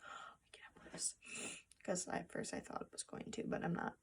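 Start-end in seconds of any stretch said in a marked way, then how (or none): 0.0-1.1 barely audible whispered disbelief; mock tearful whisper. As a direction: whisper it as if about to cry. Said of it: "I would literally be like".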